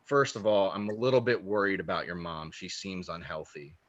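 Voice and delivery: hushed, ominous reading; dead serious psychic. Retold or imitated. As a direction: drop low and quiet like a solemn fortune teller. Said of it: "she's getting dead serious".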